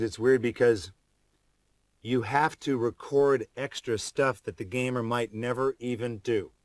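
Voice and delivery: ordinary talk, nothing marked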